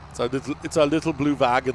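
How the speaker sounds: loud mock-German accent. Vlogger joking to himself about his trailer, German accent. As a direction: put on a heavy German accent. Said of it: "why am I talking in German".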